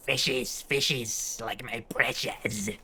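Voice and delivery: a hint of Gollum, hushed and slow. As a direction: whisper it like a creepy cave creature. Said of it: "I can't do a golem voice, but it, that's the closest I have".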